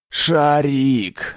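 high, long-drawn desperate call; yelling. A narrator's reading. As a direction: howl the name for help. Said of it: "заорал он". (заорав, протяжно)

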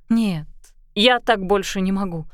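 ordinary delivery

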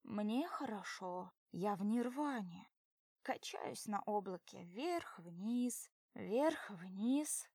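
slow dreamy tipsy drawl; slowly, blissfully drunk. Acted.

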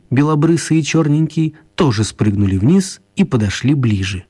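ordinary delivery

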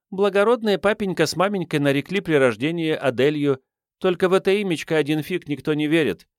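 put-on high girlish storytelling voice; little urchin reciting her pedigree; self-styled princess waif. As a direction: chatter high as a cheeky street girl.

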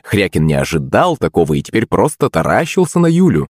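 plain reading voice

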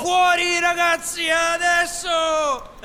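high, full-voiced rallying shout; battle cry. Peter calls the hidden boys to attack, rallying shout. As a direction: holler the boys out, stretching the last word.

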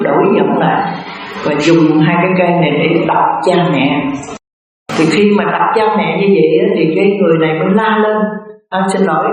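ordinary talk

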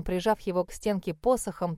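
plain reading voice

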